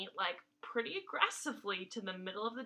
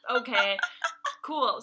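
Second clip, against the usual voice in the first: louder, mock-shocked aside; stunned reaction; 2 semitones higher, 12 dB louder, slower than usual. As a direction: react like you just got a shock. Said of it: "I was like".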